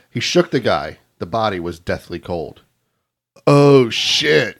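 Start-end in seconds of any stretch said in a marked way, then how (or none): 3.5-4.6 loud, startled oh shit; exclaimed. Acted. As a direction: blurt it out in shock. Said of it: "he exclaimed".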